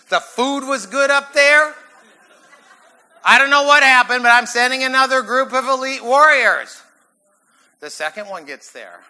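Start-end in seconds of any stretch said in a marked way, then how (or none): 0.1-1.7 high, loud, rising guess; mock incredulous Saul. Saul puzzling over his lost warriors, loud mock incredulity. 3.2-6.8 loud, high outburst of command; exasperated Saul. Acted. Saul sending another group of warriors, loud exasperation.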